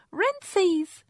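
put-on very high, loud child squeal; excited toddler. A narrator's reading. Toddler shows off in the bath, excited child voice. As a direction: crow it like a proud little girl.

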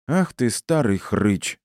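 high, drawn-out indignant scolding; flaring up. Acted. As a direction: lay into him, indignant. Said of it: "взвилась мама кошки".

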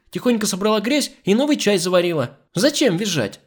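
high-pitched, cross scolding; angry command. A narrator's reading. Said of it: "Николета сердито велела". (сердитый выговор высоким голосом)

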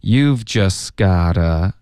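slow "You've just gotta" with the t of "just" dropped; teacher's slow, connected "You've just gotta"; slow linking demo. Shown as a demonstration. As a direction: model "gotta" with a d sound, unhurried. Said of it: "这个gotta变成的的音了".

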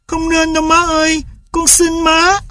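put-on high, tearful child's plea; a boy begging on the verge of tears; pleading boy. A narrator's reading. Tulip begs his mother to keep the dog, tearful. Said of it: "Và hòa khóc".

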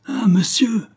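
high, loud cry of alarm. A narrator's reading. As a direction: servant crying out to his master, aghast. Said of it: "s'écria Olivain".

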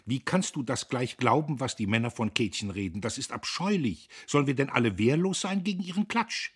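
put-on outraged young woman's outburst; indignant. A narrator's reading. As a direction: protest in indignation, building to a high finish. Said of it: "Empört ließ sie sich in der Ecke nieder".

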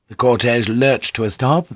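plain reading voice

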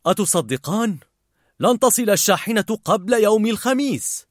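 annoyed father, voice lifted. A narrator's reading. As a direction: break bad news in irritation. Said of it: "ويبدو عليه الانزعاج".

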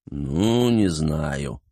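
drawn-out, unsure reply; mildly reluctant mumble of doubt; hesitant. A narrator's reading. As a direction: hedge it, dragging the first word. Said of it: "замялся тот".

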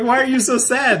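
high-pitched, retold worried question; concerned grown-ups asking. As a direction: ask it high, imitating baffled adults. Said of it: "and they're like".

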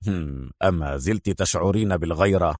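low, soft amused voice; deep, hushed man's voice; smiling male character. A narrator's reading. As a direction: tease her quietly with a smile. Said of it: "ابتسم إيفانوفيتش قائلًا".

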